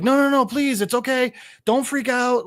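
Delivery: high, urgent mock plea; imitated pleading. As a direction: plead as if stopping someone from bolting. Avery begs fleeing man to calm.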